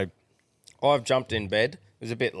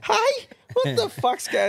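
startled; slower than usual. Retold or imitated. Startled by wife sleep talking.

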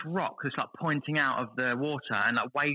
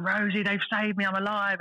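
higher, mock triumphant yell; hammed-up joyful cry of survival; retold scream; 7 semitones higher than usual. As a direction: shout to his wife from the boat. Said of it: "I'm just screaming on the boat".

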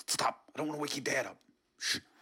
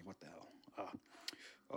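much softer whispered exclamation; 7 semitones lower, 13 dB quieter, much slower than usual. Acted. Intruder whispering in shock at the kitchen. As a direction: breathe it in shock.

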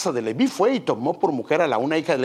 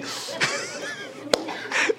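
high breathy giggle; high laughing; 5 dB quieter than usual. Real, not acted. Preacher laughing after imitating the mother, high giggle.

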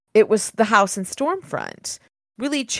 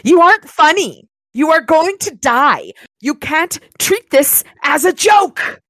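loud, high, slow shouted threat; furious villain; 8 semitones higher, 6 dB louder than usual. Acted. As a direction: bellow it as the villain losing patience. Villain Kravos threatens Harry.

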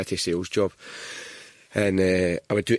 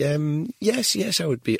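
higher-pitched imitation of willing respondent; poll respondent; 8 semitones higher, slower than usual. Friend answering a fake pollster, mock-obliging. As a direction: agree readily in a raised pitch. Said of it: "they'd be like".

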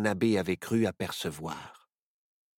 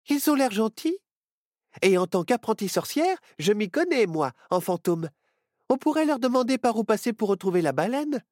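put-on high bright girl's voice; young witch girl; 12 semitones higher, 4 dB louder than usual. A narrator's reading. Myrtille cheering on her friend, high girl's voice.

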